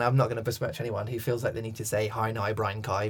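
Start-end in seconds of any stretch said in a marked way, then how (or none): 2.1-3.1 short Northern Irish trigger phrase; Northern Irish accent. Shown as a demonstration. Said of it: "straight into their Northern Irish".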